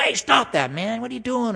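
mock-irritated protest, pitched high; hammed-up complaint from the teacher; annoyed Jesus. Jesus voiced objecting to roof damage.

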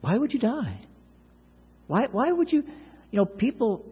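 soft, high, gently pleading question; tender plea to someone resisting God; loving plea. Shown as a demonstration. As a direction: ask it lovingly, like a worried friend. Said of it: "please do it in a loving way".

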